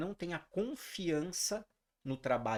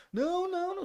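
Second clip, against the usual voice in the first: put-on high, pleading boy's voice; a scared kid begging off; mimicking a scared swimmer refusing his coach; frightened plea; 5 dB louder than usual. Scared boy refusing, quoted.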